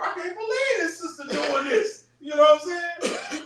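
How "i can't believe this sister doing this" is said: high-pitched mock disbelief; blown away. Onlooker catching a sister doing wrong, blown away. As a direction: imitate a stunned onlooker, pitched way up. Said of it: "you might be right there and be blown away".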